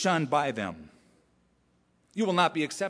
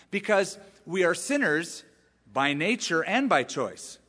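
ordinary talk, nothing marked

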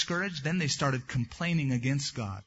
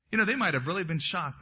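ordinary talk

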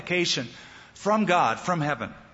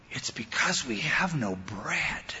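hushed worried whisper; 3 semitones lower, 5 dB quieter, slower than usual. Retold or imitated. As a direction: whisper it, worried, under breath. Disciples muttering among themselves.

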